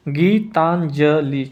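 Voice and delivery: Geetanjali drawn out very slowly, syllable by syllable; slow spelling demo. Shown as a demonstration. Teacher slowly spelling out a word for the class. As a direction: stretch out Geetanjali slowly so students catch each sound. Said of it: "छोटी ई की मात्रा आएगी".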